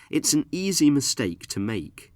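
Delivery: normal talking voice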